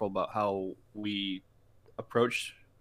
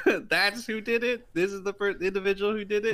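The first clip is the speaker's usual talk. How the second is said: imagined outraged manager; 10 semitones higher than usual. Retold or imitated. Old manager learns who burnt the biscuit, mock outrage. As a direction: play the manager shocked at the culprit.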